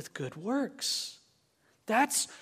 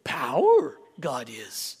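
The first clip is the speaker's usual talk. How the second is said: lower, slow Yoda impression with inverted words; 3 semitones lower than usual. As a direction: croak it like the wise Jedi master. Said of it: "Jedi Master Yoda saying".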